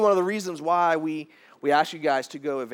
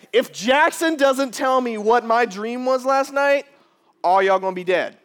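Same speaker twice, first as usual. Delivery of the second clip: angry king; 8 semitones higher, 7 dB louder than usual. Pretend. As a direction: lean on every word like a tyrant. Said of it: "let's pretend for a second".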